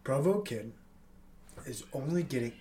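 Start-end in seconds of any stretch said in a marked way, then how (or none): none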